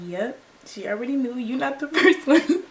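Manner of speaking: mock him while laughing. Imitating the smug boyfriend, laughing loudly.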